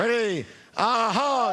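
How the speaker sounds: loud, high cheer to fire everyone up; real pep cheer; excited hype. Real, not acted. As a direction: rouse the room. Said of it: "hyping the crowd".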